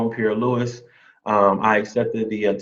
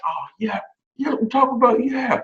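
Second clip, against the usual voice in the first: much higher, bursting with joy; excited squeal; 12 semitones higher than usual. Retold or imitated. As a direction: squeak it out, thrilled at the news. Own excited reaction to job news.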